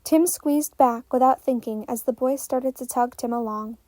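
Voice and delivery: ordinary delivery, nothing marked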